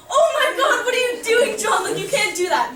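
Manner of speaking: high, loud, appalled protest; acted-out horrified scolding; mock panicked outcry. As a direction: shriek at a friend who went too far. Friends' panicked reaction, acted out.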